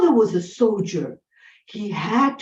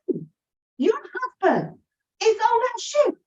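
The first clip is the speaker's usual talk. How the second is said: stranger calling out; 12 semitones higher, faster than usual. Acted. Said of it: "somebody said".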